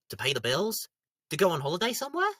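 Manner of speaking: usual speaking voice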